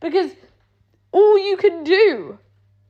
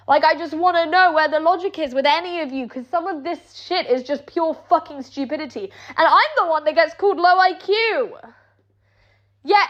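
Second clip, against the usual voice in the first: ordinary delivery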